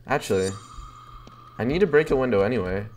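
usual speaking voice

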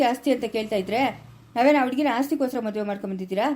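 ordinary delivery

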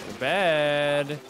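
long drawn-out command; slow, mock-insistent nagging; playful bedtime order. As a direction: stretch each word in a teasing order. Playfully ordering friend to bed.

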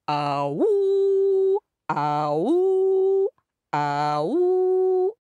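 high hound howl; ringing ah-woo, pitched right up; dog howl. Acted. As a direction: swoop up into a long woo.

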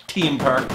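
"Team" said with a hard T for Theme; Irish T for TH. Shown as a demonstration. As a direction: say "Team Park" with no th sound. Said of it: "did you see the way I say it".

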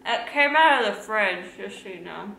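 talking while chewing; words through a mouthful of cake; mouth full. Real, not acted. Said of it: "You're talking with your mouth full".

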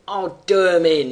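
loud whining threat; whined threat. Acted. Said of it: "he whined".

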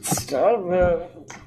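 normal talking voice

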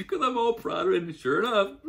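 high, loud, laughing through the words; dad laughing as he tells of his son's wipeout. Real, not acted. Laughing while recounting son's fall.

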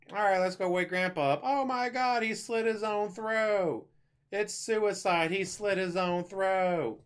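high-pitched, drawn-out mock horror; mock shock. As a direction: play the shocked discovery, high and slow. Acts family finding Grandpa dead.

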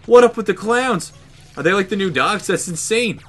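high, loud amazed rambling; excited disbelief. Real, not acted. Gamer still shaken by clowns, talking high and loud. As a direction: keep the shock going, high and loud.

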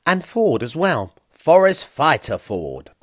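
a mild take on Ford's bellow; hint of a big forest fighter; imitating Ford. A narrator's reading. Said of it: "he said in a voice imitating Ford's".

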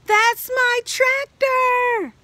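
high, loud, slow joyful cry; a delighted mouse finally finding his tractor; happy mouse. Acted.

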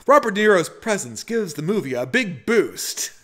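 high, emphatic mock-review voice; hammed-up American Psycho monologue, pitched high; Patrick Bateman. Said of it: "part of the Patrick Bateman routine".

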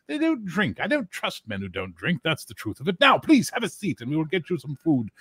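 high, chatty barkeep voice; high gossipy innkeeper; gossiping innkeeper. Acted. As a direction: grumble about foreigners, high. Innkeeper gossips about knights.

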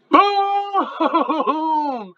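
long, high "boom" as the bomb lands; gleeful boom. Real, not acted. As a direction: stretch it out in high-pitched triumph.

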